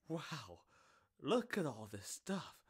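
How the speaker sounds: normal talking voice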